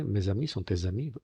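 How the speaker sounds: MES AMIS, TES AMIS linked with a Z; voiced S liaison. Shown as a demonstration. Teacher showing the commonest case, MES AMIS and TES AMIS joined by buzzing Z. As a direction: say "may-za-mee", "tay-za-mee" for MES AMIS, TES AMIS. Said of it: "suena una S además sonora".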